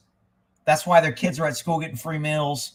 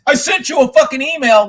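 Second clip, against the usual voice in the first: loud, high mock nagging; exaggerated pestering mimicry; mocking commenter; 8 semitones higher, 6 dB louder than usual. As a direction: imitate a pushy commenter, pitched way up.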